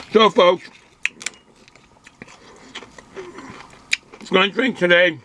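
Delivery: chewing while talking; words round a mouthful of food; talking while eating. Real, not acted. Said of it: "Excuse me if I'm talking while I'm eating".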